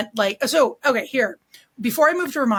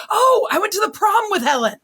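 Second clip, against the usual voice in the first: much higher, louder, delighted local; mock delighted recognition; 11 semitones higher, 5 dB louder than usual. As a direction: toss it off like it's the most natural coincidence. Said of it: "the guy was like".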